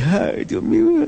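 very high, squeaky pretend sob; hammed-up crying lament, pitch leaping up; mock tearful wail. Preacher mimicking someone caught up in others' crying, mock wail. Mimics Jesus joining the weeping.

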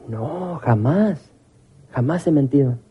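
mock-innocent woman's denial, lightly voiced; lightly acted indignant denial. Churchwoman answering the pastor's question, lightly acted denial. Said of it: "lo primero que le pregunté fue".

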